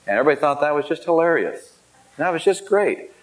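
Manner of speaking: high, fast mock amusement, lightly done; mock-delighted peers, sarcastic and fast. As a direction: echo their teasing with a sneer. Sarcastic remark on peers' mockery.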